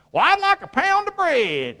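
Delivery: high, put-on shopper voice; lightly voiced buyer at the market; mock customer. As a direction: play a customer asking for bread. Mock customer at bread shop.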